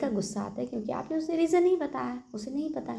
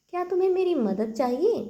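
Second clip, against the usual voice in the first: eager offer; 8 semitones higher, 6 dB louder than usual. Shown as a demonstration. Offering help, modelled question.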